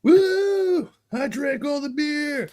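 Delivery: high whooping brag, dragged out slow; imitated drunken cheer. Friend mimics the drunk guy after his beer, high whooping cheer. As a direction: whoop it up like the proud fool at a party.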